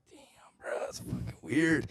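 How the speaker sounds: whispered retold reaction; hushed aside, acting out amazement; awed whisper. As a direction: whisper it as if stunned.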